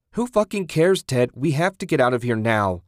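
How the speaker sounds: high, loud exasperated shout; angry urging, starting very high; frantic outburst. A narrator's reading. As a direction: explode with panicked impatience. Susan frantically urges Ted to flee.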